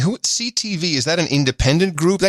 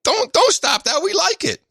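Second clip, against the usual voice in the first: high-pitched Canadian fans pleading; squeaky plea not to stop, mock-Canadian; 13 semitones higher than usual. Host voices Canadian fans begging him to keep the jokes, high-pitched. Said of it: "what people tweeted back at me".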